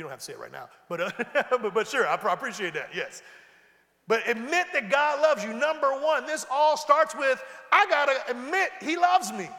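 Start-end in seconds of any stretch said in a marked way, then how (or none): 4.1-5.5 louder preaching emphasis. Shown as a demonstration. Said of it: "I need you to admit". 5.5-9.5 rising to a full shout on the confession; preaching at full force. Real, not acted. Preacher shouting the confession to the church.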